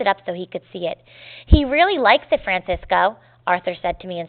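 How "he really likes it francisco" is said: put-on raised, louder voice for a boy's remark; lively storybook dialogue, starting high and loud; enthusiastic quote. A narrator's reading. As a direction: read the boy's line with eager emphasis, easing on the name. Boy's quote in story read aloud.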